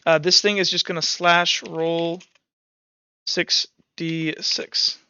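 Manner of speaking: normal talking voice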